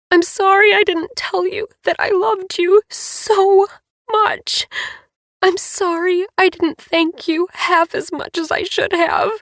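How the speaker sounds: high, loud, sobbing apology. A narrator's reading. Livy sobbing to her dying friend, high tearful apology. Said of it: "now I was sobbing again".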